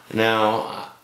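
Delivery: ordinary talk, nothing marked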